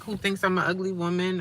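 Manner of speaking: normal talking voice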